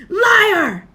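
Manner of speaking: sudden high furious shout; boy yelling defiance at the Dark Lord. A narrator's reading. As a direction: hurl the word back at him. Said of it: "Harry shouted suddenly".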